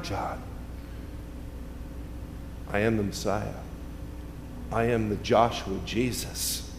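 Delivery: soft, low reassurance from Jesus; quiet Jesus voice. Acted. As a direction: comfort John gently. Jesus speaks quietly to John.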